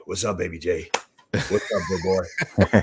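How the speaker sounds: low, soft retold greeting; Darryl Strawberry. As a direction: imitate a big man's hushed, low hello. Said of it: "he would call me Baby J".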